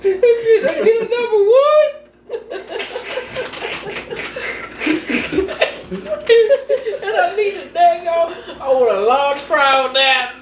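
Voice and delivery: high, slow, cracking up mid-order; can barely order for laughing; laughing to tears. Retold or imitated. Mock drive-thru order while laughing to tears.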